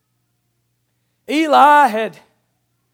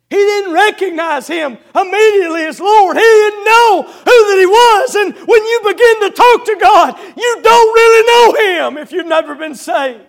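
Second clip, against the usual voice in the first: loud, higher fervent preaching; preaching at full force; 6 semitones higher, 8 dB louder than usual. Acted. Preacher to unsaved listeners, loud urgent preaching. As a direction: hammer the need to be saved.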